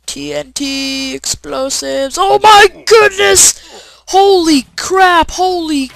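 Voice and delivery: high, very loud, slow shrieks; panicked screaming. Real, not acted. Gamer freaks out over TNT explosives, high and loud. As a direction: scream in real alarm at the blast.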